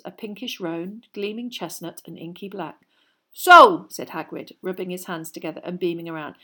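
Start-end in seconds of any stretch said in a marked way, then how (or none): 3.3-3.9 big booming hearty giant; a jolly loud gamekeeper. A narrator's reading. Hagrid voiced in story.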